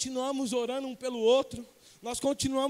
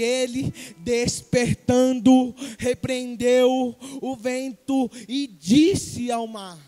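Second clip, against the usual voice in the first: loud, slow, drawn-out declamation; dramatic preaching; 10 dB louder, much slower than usual. Acted. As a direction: stretch each word out with force. (declamação lenta e forte)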